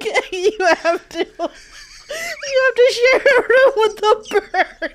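mock parent voice. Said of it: "Them being like".

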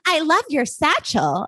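high, slow sweet princess voice; cartoon princess. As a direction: gush like a theme park princess. Rapunzel voiced in story.

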